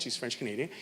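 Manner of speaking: normal talking voice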